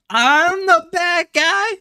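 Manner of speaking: very high, slow squeaked question; squeaky mock outrage. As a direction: squeak it out like a cartoon victim. Mock outrage, pushed further.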